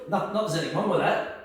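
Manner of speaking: usual speaking voice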